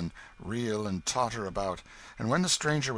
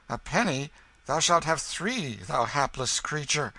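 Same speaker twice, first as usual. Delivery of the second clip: benevolent stranger, pitying; 7 semitones higher, 5 dB louder than usual. A narrator's reading. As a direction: offer more with eager pity.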